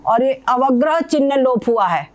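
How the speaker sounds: ordinary talk, nothing marked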